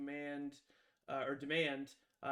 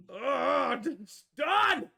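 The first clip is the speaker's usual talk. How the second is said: high, loud exclamation; excited cry; 10 semitones higher, 12 dB louder, much slower than usual. Real, not acted. Player reacting to a botched roll, high loud cry. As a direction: burst out at the bad roll.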